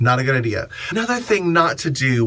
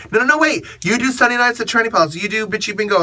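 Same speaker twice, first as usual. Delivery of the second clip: pushy fan; 8 semitones higher, much faster than usual. Retold or imitated.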